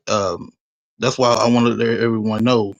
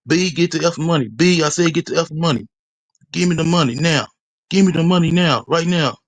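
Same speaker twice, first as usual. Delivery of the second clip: higher, pushier mock demand, building; imitated robber's threat; 6 semitones higher than usual. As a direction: bark the robber's demand higher and harder, getting more insistent. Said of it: "he was saying was".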